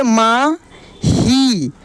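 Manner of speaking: मा, pause, then a long stretched ही; drawn-out syllables. Shown as a demonstration. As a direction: break मा ही apart and hold each vowel. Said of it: "खींच कर किया जाता है". (मा ही, खींच कर अलग-अलग)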